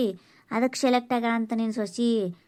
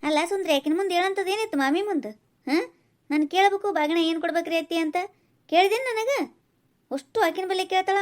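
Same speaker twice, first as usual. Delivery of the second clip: higher-pitched, accusing questions; indignant scolding; 5 semitones higher than usual. Acted. Mother-in-law confronts Sundri for asking a neighbour, indignant. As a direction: take her to task, voice raised in pitch.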